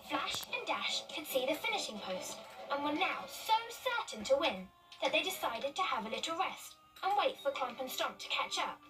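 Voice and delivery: ordinary delivery, nothing marked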